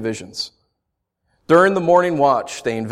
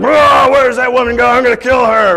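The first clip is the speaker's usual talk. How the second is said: loud, high mock rage; crazed axe murderer; 11 semitones higher, 9 dB louder than usual. Preacher voicing a crazed axe murderer hunting a woman, loud and high. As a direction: yell like a crazed killer.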